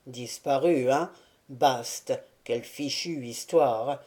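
low, gruff grumble with "Disparu" falling; grumbling. A narrator's reading. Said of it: "laissa échapper un petit rire et grommela". ("Disparu" grommelé, grave et tombant)

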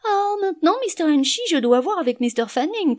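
hammed-up high mimicry of a stalling man; mocking imitation. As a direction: mimic him putting you off. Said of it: "plutôt que de dire".

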